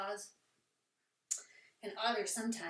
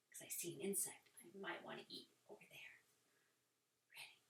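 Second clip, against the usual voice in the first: quick hushed whisper; 4 semitones lower, 16 dB quieter, faster than usual. Shown as a demonstration. Teacher as a frog stalking an insect, whispering. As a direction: whisper it so the insect can't hear.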